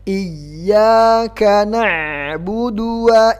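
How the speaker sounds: slow melodic tajwid chanting; teacher chanting the verse in class; Quran recitation. Shown as a demonstration. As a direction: chant the verse slowly and evenly.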